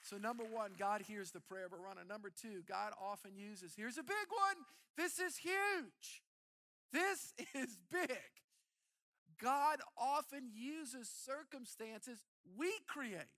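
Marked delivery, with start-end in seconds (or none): 3.8-8.2 high, loud and slow build-up; emphatic. Real, not acted. Pastor to congregation, hyping the point, high and loud. 9.4-13.2 slow, loud, each word weighed; slow emphasis. Real, not acted. Pastor to congregation, slow loud emphasis. Stating the key point.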